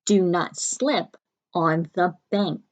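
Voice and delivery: slow, even dictation voice. Shown as a demonstration. Teacher dictating the sentence slowly to the class. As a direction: read it out slowly for writing. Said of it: "So our sentence".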